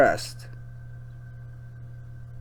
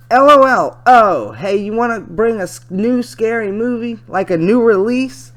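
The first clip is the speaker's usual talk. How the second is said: lightly voiced girl reading chat; a hint of an eager young girl; eager teen girl; 9 semitones higher, 9 dB louder than usual. Reads chat as teenage girl.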